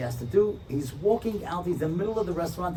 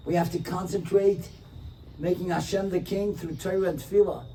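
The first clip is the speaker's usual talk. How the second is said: normal talking voice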